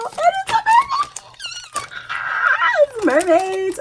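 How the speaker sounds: very high, drawn-out squeal of delight. Real, not acted. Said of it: "I'm like a little kid right now".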